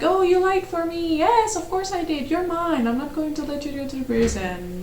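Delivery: sneering parody of the hero thanking her, sappy and high; mocking lovestruck hero. Mocking the hero's reply.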